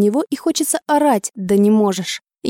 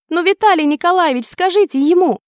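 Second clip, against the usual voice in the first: higher-pitched plaintive appeal; complain, pitched up, asking for backup; 7 semitones higher than usual. A narrator's reading. Woman appealing to a colleague about an unruly patient, complaining. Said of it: "Пожаловалась кому-то".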